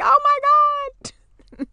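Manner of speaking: very high, slow drawn-out gasp of joy; overwhelmed squeal. Retold or imitated. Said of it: "And I'm like".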